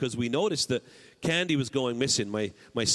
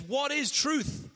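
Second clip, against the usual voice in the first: Pilate scoffing; 10 semitones higher, much slower than usual. Acted. Pilate's question read aloud.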